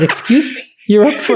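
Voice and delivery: high, stunned "excuse me"; shocked disbelief. Retold or imitated. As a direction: react like you can't believe the number. Shocked at losing forty thousand.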